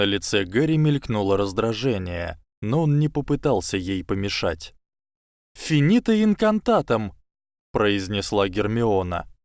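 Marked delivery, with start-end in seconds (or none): 5.5-7.1 high, firm spellcasting; spell incantation. Acted. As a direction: call out the spell sharply. Hermione casts counter-spell.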